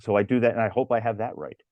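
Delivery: normal talking voice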